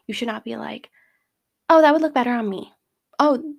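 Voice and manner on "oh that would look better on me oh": louder, higher cocky put-down; lightly hammed-up one-upping; mock cockiness. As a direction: act out a cocky girl showing off. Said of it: "you should not be cocky".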